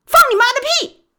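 very high, loud curse; furious yell. Acted. Baoli cursing the thugs, a furious high yell. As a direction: explode at them. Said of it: "大喊一声".

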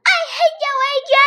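put-on high, loud girl's cry; girl's outburst. A narrator's reading. Andrea yells at AJ in a high voice. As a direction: hurl it at him, high. Kids' quarrel.

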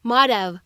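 "Might have" as "mad-av"; flapped might have. Shown as a demonstration. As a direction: link "Might have" with a flap. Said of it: "我们可以读成".

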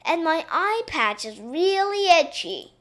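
high, whiny upset princess; sobbing complaint. A narrator's reading. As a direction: wail it as a childish grievance. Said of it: "she sobbed".

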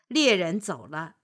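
ordinary delivery, nothing marked

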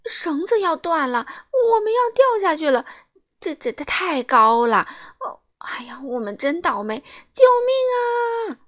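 terrified on a breaking rope; panicked shouting. Acted. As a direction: panic building to a scream for help. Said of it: "他惊慌地喊道".

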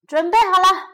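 loud, high starter's call. A narrator's reading. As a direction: announce it loudly to all the racers. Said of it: "罗杰说：".

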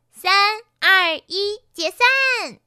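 high, loud countdown command; announcing. A narrator's reading. The real Pinky dismisses her clones, announcing loudly. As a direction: call out the countdown and release the troops. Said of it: "真碧琪宣布".

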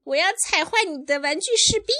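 high, loud, fast little-sister voice; rapid squeaky threat; Rosie. Acted. Parent voicing the sister's comeback, high and loud. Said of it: "Rosie 说".

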